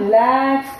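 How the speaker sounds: normal talking voice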